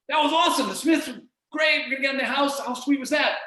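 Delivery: high, loud, thrilled celebration; excited positive voice. Retold or imitated. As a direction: cheer it like a big win. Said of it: "Your positive voice is like".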